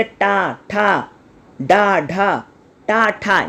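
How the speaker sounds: टा ठा डा ढा said slowly with the tongue curled back; retroflex syllables demo. Shown as a demonstration. Teacher demonstrating retroflex sounds to the class, slow and deliberate. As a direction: sound out टा ठा डा ढा carefully, one by one. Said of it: "मूर्धा".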